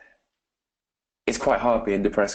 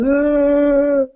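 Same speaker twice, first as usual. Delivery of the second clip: put-on a high, loud wail like a sobbing little girl; imitated child's wail; 9 dB louder than usual. Telling the story of crying Jessica, a high loud wail. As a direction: cry out the way the upset girl did.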